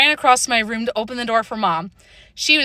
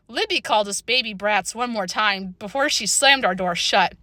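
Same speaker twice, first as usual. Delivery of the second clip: ordinary delivery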